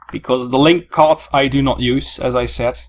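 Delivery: usual speaking voice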